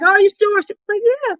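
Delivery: high voiced back-and-forth; squeaky quick are you sure; high lightly voiced quotes; mock exchange. Retold exchange.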